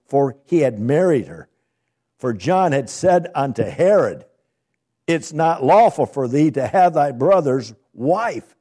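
loud, rising preacher's reading of scripture; booming pulpit reading. Acted. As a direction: proclaim the verses to the congregation.

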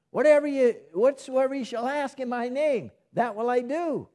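high, loud ringing proclamation; declaiming scripture. Acted. Preacher proclaiming Jesus' promise to the congregation, high and ringing.

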